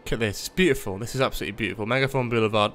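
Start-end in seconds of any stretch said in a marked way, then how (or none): none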